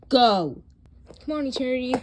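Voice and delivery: loud sharp order to leave; urgent yelled go; shouted command. Acted. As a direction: bellow it at them. Ordering them away.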